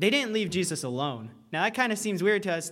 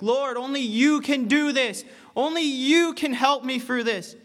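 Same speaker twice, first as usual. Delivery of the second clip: higher and louder pleading prayer; crying out to God; 6 semitones higher, 5 dB louder than usual. Retold or imitated. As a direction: call out to the Lord in need. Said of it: "crying out to God".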